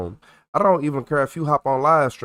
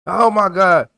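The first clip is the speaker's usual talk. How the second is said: surprised exclamation; 7 semitones higher, 7 dB louder, slower than usual. Retold or imitated. Imitating a drunk guy collapsing.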